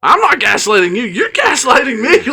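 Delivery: mock protest. Objecting to partner's gaslighting accusation, mock protest. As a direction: imitate turning an accusation back, pitch climbing. Retold quarrel over gaslighting.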